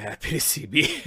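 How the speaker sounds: usual speaking voice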